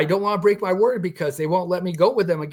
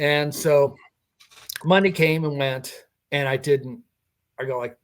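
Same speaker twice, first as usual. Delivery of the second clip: ordinary talk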